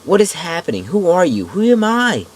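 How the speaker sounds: high, loud frantic questions; desperate pleading shout. Acted. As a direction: plead for answers at the top of your voice. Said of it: "I beg".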